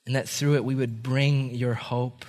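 low, soft, unhurried praying; quiet closing prayer; heartfelt prayer. Real, not acted. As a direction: pray gently, pausing between phrases.

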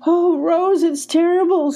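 high, distressed wail; crying. A narrator's reading. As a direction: wail it to a friend. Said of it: "said Emmy, crying".

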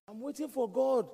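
high, loud imitation of a stubborn man; insistent cry. Flood victim to the speedboat, acted insistent cry. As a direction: call it out, pitched well up. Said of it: "And the guy begged".

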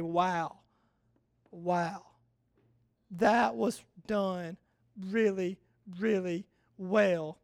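soft, slow, hushed wonder; quiet awe. Retold or imitated. Preacher showing the congregation how to marvel at God, hushed and slow. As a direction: breathe it out in quiet amazement. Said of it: "step back and say".

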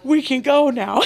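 insist, on the edge of laughing; amused insistence. Retold or imitated. Mom tells eager daughter to leave, laughing insistence.

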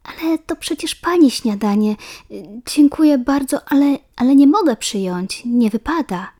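put-on polite girl refusing. A narrator's reading. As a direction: decline politely, embarrassed.

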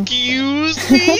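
slow, high, loud indignant cry; mock offended. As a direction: make it a scandalised shriek. Repeats mock offense louder.

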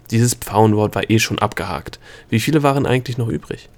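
ordinary delivery

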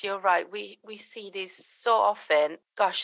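ordinary talk, nothing marked

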